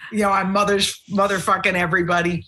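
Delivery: normal talking voice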